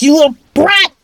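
angry yell. Acted. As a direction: yell the insult in frustrated rage. Blinded villain yells at victim.

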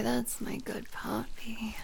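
hushed, breathy praise; whisper. Acted. Whispers praise to her partner, soft whisper. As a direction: whisper it close to the ear.

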